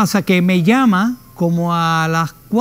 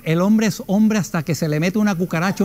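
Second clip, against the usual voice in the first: usual speaking voice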